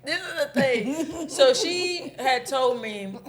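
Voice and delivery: laughing through the words; trying to talk through laughter. Real, not acted. Laughing, trying to resume story.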